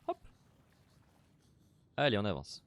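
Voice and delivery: usual speaking voice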